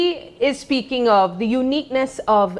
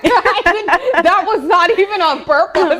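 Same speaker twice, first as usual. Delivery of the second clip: talking through laughter, higher pitched; laughing; 7 semitones higher than usual. Real, not acted. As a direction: protest through your own giggles. Laughing at accidental pun.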